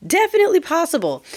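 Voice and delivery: high-pitched definitely, insistent second take; emphatic high repeat. Shown as a demonstration. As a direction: push it up high and stress definitely. Repeats phrase, higher emphatic take.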